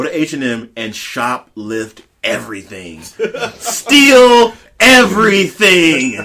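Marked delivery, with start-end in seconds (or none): 3.0-6.1 laughing it out, drawn out; giggling while saying it. Real, not acted. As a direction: cackle through the words. Laughing while urging theft.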